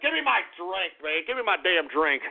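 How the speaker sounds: usual speaking voice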